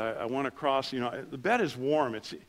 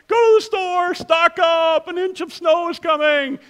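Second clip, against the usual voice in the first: much higher, louder alarm, hammed-up; mock panic; 11 dB louder than usual. Pastor mimics southern snow warning, hammed-up panic. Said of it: "it was like".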